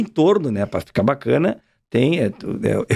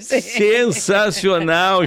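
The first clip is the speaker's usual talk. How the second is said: drawn out; 5 semitones higher, much slower than usual. Real, not acted. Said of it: "drawn-out praise after song".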